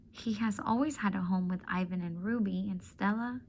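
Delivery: usual speaking voice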